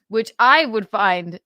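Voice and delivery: ordinary delivery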